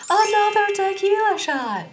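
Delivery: bell-like announcement. Acted. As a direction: ring it out high like a bell. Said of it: "a bell that plays, like".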